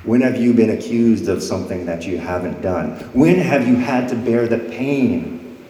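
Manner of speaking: ordinary delivery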